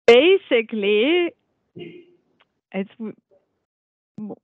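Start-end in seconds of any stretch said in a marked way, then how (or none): none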